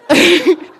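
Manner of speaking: loud high laugh bursting out; burst of laughter. Real, not acted. Laughs at own mistake.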